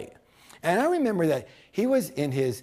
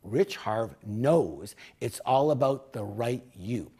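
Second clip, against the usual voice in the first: ordinary talk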